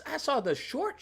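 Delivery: normal talking voice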